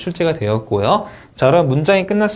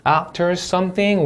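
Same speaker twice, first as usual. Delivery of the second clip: "After something" lifted high at the end; rising tune; 6 semitones higher, much slower than usual. Shown as a demonstration. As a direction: read "something" slowly, pitch going up. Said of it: "올려 있고". ("something"을 올려 읽기)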